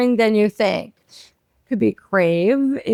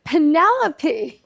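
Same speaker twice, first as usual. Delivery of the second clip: silly high voice; 8 semitones higher, 3 dB louder, slower than usual. Pretend. Voicing her named vagina.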